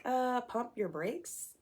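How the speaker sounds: slow, high, drawn-out "whoa there"; stretched-out, high-pitched mock hesitation; mock balking. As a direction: imitate someone putting on the brakes. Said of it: "I understand them being like".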